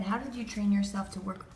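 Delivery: ordinary talk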